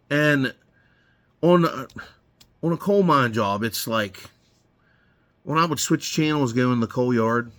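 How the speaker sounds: usual speaking voice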